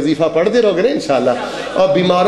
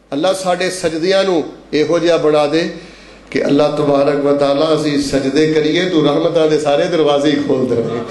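low-pitched supplication; subdued, low prayer voice; 5 semitones lower than usual. Real, not acted. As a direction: bring the pitch down and pray.

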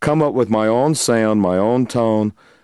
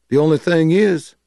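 raised-pitch imitation, setting up the punchline; 7 semitones higher than usual. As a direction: hold them in suspense as the teasing bluesman. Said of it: "he looks at me and he goes".

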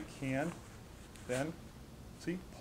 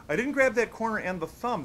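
didn't grab said higher and louder, grab punched hard; stress on grab; 6 semitones higher, 6 dB louder than usual. Shown as a demonstration. Instructor warning students off the wrong grip, emphatic. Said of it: "That'll really gum things up".

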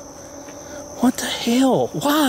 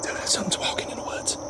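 whispering; 10 dB quieter than usual. Real, not acted. Hears something in the woods.

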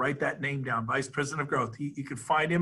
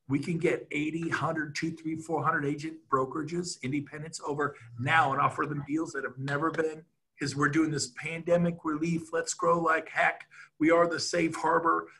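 ordinary talk

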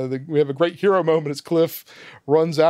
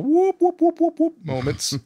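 high rising run of whoops; comic high whooping; imitated whoop noise; 13 semitones higher than usual. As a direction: whoop upward like a slide whistle. Mimics a turn-the-tables sound.

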